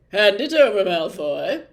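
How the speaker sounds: loud stern prefect command. A narrator's reading. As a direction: order it sternly. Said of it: "said Percy sternly".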